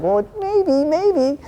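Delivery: mock your own hope in falsetto; high-pitched wishful thinking. Storyteller mocking himself for being blinded, high and singsong.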